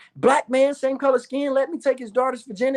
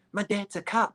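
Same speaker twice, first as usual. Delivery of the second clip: put-on girlfriend's voice; 6 dB quieter than usual. Girlfriend reveals her dad.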